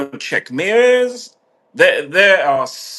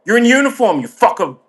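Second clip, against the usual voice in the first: higher, louder accusing outburst; furious accusation; 8 semitones higher, 3 dB louder, faster than usual. Retold or imitated. Confronting the abuser in court, a raised furious outburst. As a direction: confront the abuser in uniform with rage.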